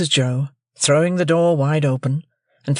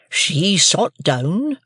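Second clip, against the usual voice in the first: slow, higher, simple blacksmith; Joe's slow country voice; 5 semitones higher, much slower than usual. A narrator's reading. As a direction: Joe tells Pip, slow country drawl. Joe telling Pip.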